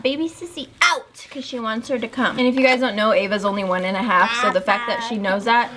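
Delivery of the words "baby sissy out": put-on toddler voice. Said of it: "She always says that".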